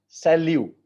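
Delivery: "Salut" with a silent final t, sa-LU; teacher models "Salut", no t at the end. Shown as a demonstration. Teacher modelling a word for students, saying "Salut" with no final t. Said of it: "T is silent".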